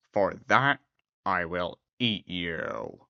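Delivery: low, soft beast threat; werewolf. Acted. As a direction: menace low and slow.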